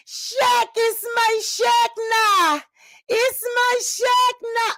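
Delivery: cling to your son; doting mother. Acted. Mother welcoming Shaq home, high slow doting.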